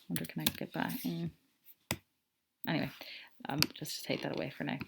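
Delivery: quick whispered note to herself; whispered aside. Real, not acted. Teacher tidies her page mid-lesson, whispering to herself. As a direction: drop to a fast whisper, off-mic.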